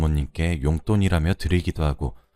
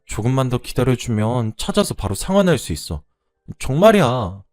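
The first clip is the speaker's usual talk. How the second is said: pleading excuse; 5 semitones higher, 4 dB louder than usual. Acted. Tearful borrower asks lender for time, pleading excuse. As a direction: beg to be believed.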